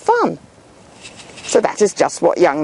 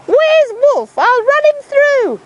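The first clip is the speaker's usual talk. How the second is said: much higher, fierce shrill mum; yelling mum. Acted. Mum yelling for the wolf, fierce. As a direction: brandish the shoe and yell. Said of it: "She yelled".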